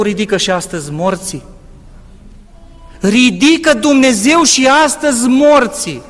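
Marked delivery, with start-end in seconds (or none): 3.0-6.0 fervent preaching. Acted. Preaching at full force.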